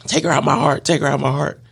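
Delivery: high-pitched mock desperation; pleading prayer. Man begs God to remove his feelings, high plea.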